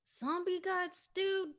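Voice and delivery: high, slow, disbelieving "Zombie guts, dude?"; incredulous. Acted. Bear staring at Nathan in disbelief, high and drawn out. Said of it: "Bear looks over at Nathan like".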